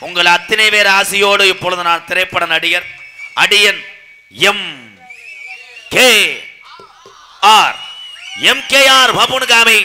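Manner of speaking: loud announcement of your own billing; big projected stage voice; stage announcer. Acted. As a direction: proclaim each title to the crowd, letter by letter. Announces his stage titles.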